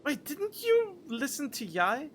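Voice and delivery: ordinary delivery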